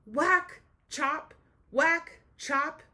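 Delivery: high, loud whack and chop; playful, loud axe sound effects. A narrator's reading. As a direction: hit each word like an axe.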